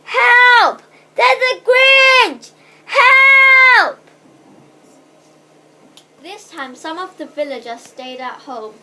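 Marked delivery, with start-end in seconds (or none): 0.0-4.0 very high, loud cries for help; top-of-lungs shouting. A narrator's reading. Bored girl faking an alarm to villagers, shouting. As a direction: holler for help, stretching the words. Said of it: "at the top of her lungs".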